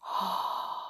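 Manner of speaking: quick breathy intake of breath; hushed startled gasp; soft gasp. A narrator's reading. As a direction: gasp quietly in suspense. Suspense beat in reading.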